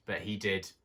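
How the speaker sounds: ordinary delivery, nothing marked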